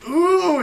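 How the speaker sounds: high, drawn-out squeamish "ooh"; cringing ooh. Retold or imitated. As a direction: wince aloud at a gruesome memory. Recoiling from remembered gruesome scene.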